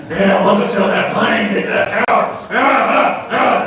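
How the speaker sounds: loud, high cocaine rant, hammed-up; wired outburst breaking into loud cries; coked-up ranting. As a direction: act out someone wired on cocaine, shouting. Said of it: "That's cocaine for you".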